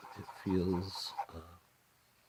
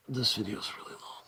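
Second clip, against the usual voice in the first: whispering. Real, not acted. Whispered aside.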